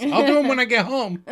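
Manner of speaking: high, loud defeated squeak; caving in. Retold or imitated. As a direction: give in with comic horror. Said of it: "the horror of the dirty dishes compels".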